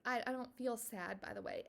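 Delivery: ordinary talk, nothing marked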